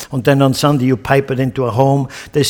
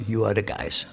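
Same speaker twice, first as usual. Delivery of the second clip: much softer, low confiding voice; hushed accusation; 6 semitones lower, 12 dB quieter than usual. Retold or imitated. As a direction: lean in and point the finger quietly. Peter confronting, hushed retelling.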